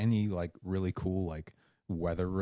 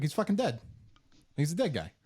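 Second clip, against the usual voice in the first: high, incredulous retelling of his own words; mock exasperated; 7 semitones higher than usual. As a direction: act stunned that nobody gets it. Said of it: "was like".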